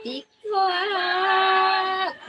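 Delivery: long high sung crow; sung cock-a-doodle-doo; rooster crow. Shown as a demonstration. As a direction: stretch it out like a crowing rooster. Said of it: "Tiktilaok, she said".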